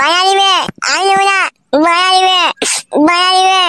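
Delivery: put-on high, pleading child's voice; whiny little boy. Small son begging father for big doll, whining. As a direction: beg like a spoilt kid.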